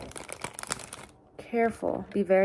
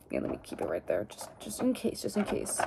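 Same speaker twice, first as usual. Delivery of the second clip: soft unvoiced whisper; whispered aside; 11 dB quieter than usual. Real, not acted. Keeping the tape just in case, whispered to herself. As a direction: whisper it to yourself.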